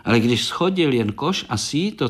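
ordinary delivery, nothing marked

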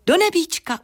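high, cheery ladybird voice; smiling. A narrator's reading. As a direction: answer brightly through a smile. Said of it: "usmála se beruška".

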